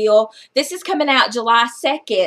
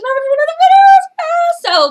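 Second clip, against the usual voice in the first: high, louder thrilled announcement; 7 dB louder than usual. Real, not acted. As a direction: squeal about winning. Announcing she won giveaway.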